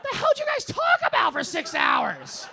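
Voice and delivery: yelling through laughter; laughing shout. Real, not acted. Laughing disbelief.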